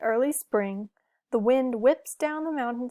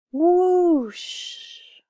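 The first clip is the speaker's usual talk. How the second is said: loud whooshing wind; 8 semitones higher, 6 dB louder than usual. A narrator's reading. Wind wrecks the castle.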